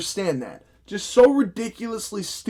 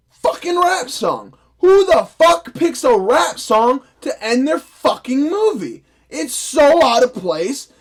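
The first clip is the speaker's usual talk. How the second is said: louder, higher and slow, every word hammered; exasperated rant; 7 semitones higher, 6 dB louder than usual. Real, not acted. Ranting to viewers about the rap song ending, loud and slow. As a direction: rail at the film like you can't believe it.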